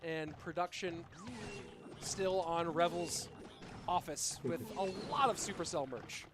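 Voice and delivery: slow, drawn-out caster call; live play-by-play. Acted. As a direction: announce the opening like a match caster. Calls the match start.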